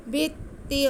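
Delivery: Quran recitation. Shown as a demonstration. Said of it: "जाल को बहुत नरमी से अदा करना".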